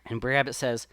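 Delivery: ordinary delivery, nothing marked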